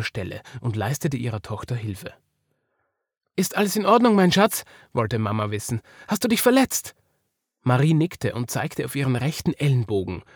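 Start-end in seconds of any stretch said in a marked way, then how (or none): 3.4-4.6 lightly acted, higher motherly concern; raised voice of a concerned parent; worried mother. A narrator's reading. Mother checks on fallen daughter. 6.1-6.9 high-pitched anxious question; concerned parent, voice lifted high; worried mother. A narrator's reading. Mother checks on fallen daughter.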